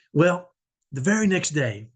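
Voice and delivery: usual speaking voice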